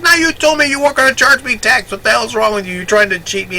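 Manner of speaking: high, loud, outraged accusation; indignant outburst. Retold or imitated. Walter accuses the clerk of cheating him, high and outraged. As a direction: call him a cheat to his face.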